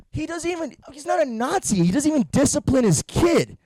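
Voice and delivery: ordinary delivery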